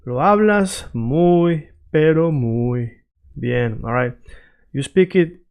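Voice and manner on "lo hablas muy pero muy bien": slow, stretched-out praise; slow, deliberate reassurance in Spanish; emphatic compliment. Acted. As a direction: draw out each muy to lay it on. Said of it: "they're gonna tell you".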